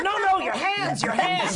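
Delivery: high, loud, panicked imitation; frantic shouted warning. Crew stopping the actor running back on stage, frantic. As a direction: yell it to stop someone mid-run.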